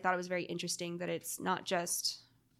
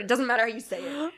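ordinary talk

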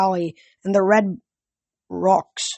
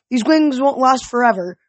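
raised, higher-pitched urgent call; yelled warning; 5 semitones higher, 3 dB louder than usual. A narrator's reading. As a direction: call out a warning mid-flight, moderately raised. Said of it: "Annabeth yelled".

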